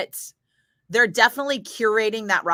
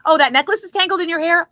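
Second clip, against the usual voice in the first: higher, louder, over-eager offer; eager fan helper; 10 semitones higher, 8 dB louder than usual. Retold or imitated. Fan working backstage offering the singer powder, over-eager and loud. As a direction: gush like a fan who'd do anything. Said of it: "I did not care what that was".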